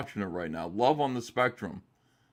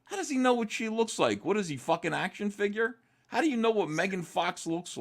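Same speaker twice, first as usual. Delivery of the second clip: incredulous; 9 semitones higher than usual. Real, not acted. Incredulous rant about blind dating.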